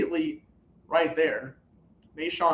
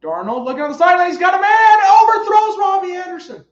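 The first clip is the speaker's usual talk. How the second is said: fast, higher and louder call of the throw; excited play-by-play; 11 semitones higher, 12 dB louder than usual. Real, not acted. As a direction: call the pass live as the pitch climbs. Live call of deep throw.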